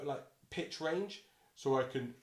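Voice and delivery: ordinary delivery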